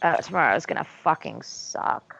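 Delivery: ordinary delivery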